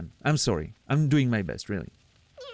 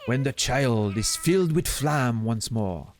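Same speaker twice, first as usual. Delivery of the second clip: put-on French accent with French words. Streamer retrying Grimm's line, fuller French accent. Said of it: "actually using, like, the French words".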